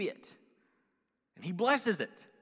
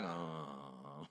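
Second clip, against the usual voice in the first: zombie groan; 15 dB quieter than usual. Retold or imitated. Zombie crash after Red Bulls.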